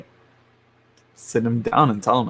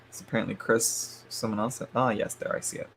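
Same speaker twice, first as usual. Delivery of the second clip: much softer, half to herself; quiet aside; 9 dB quieter than usual. Real, not acted. Checking PayPal, quietly talking to herself. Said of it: "Sorry for the yawn".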